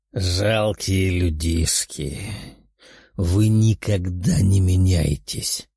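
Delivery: low, slow demonic voice; menacing demon. A narrator's reading. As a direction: sneer down at puny humans. Demon character voice.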